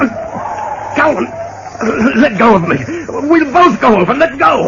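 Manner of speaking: high, panicked pleading. Acted. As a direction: beg for your life. Struggling on bridge edge.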